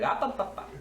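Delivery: warning ah ah ah. Acted. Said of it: "I'll just be like".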